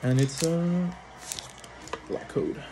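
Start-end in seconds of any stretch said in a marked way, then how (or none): 2.1-2.6 whispered under the breath; hushed. Real, not acted. Opening packs, whispering the code to himself first. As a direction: breathe the card's name to yourself. Whispered then repeated aloud.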